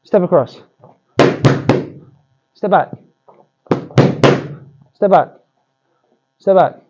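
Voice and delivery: crisp called commands; boxing pad coach. Shown as a demonstration. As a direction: call each cue sharply to the boxer. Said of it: "I can now cue it with my voice".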